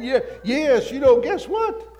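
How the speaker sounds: joyful boast to his wife of big sales, excited and loud. Retold or imitated. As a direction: gush it out, thrilled and laughing. Bursting with his good news.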